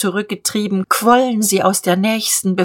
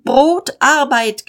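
much higher protest cry; high demonstrators' call; crowd shouting; 7 semitones higher, 3 dB louder, slower than usual. Acted. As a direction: shout the slogan out.

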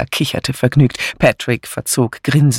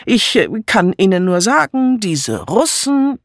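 pompous colonel; 8 semitones higher than usual. A narrator's reading. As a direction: lecture him like an old colonel. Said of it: "sagte der Colonel".

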